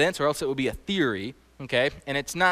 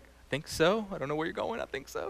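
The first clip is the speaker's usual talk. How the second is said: mock unsure mumble; 10 dB quieter than usual. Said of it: "Everyone's like".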